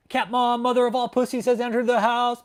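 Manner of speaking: loud, high ring announcer. Pretend. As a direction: announce her entrance with pomp. Announces the cat's entrance.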